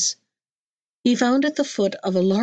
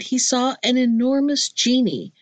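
plain reading voice

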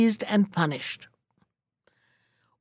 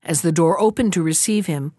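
ordinary delivery, nothing marked

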